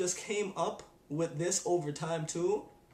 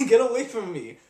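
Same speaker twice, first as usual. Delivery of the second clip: loud, higher laughing get away; laughing shout; 6 semitones higher, 11 dB louder than usual. Retold or imitated. To someone calling him a number, loud laughing brush-off.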